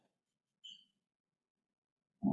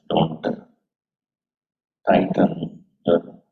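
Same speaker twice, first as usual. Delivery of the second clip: guided meditation. Acted. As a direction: guide them gently into stillness. Leads a meditation.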